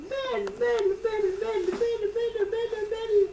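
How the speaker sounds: high, soft sing-song repeats. Real, not acted. Grandmother cooing over the baby, high soft sing-song. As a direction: croon it like a lullaby.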